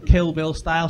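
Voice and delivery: ordinary talk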